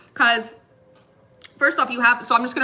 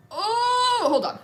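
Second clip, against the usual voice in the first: surprised laugh; 11 semitones higher, slower than usual. Real, not acted. Surprised by card count.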